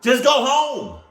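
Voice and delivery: high, loud, drawn-out holler; exasperated yell. Real, not acted. Viewer yelling at characters who linger, exasperated yell. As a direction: yell it slowly with pitch way up.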